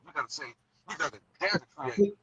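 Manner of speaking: usual speaking voice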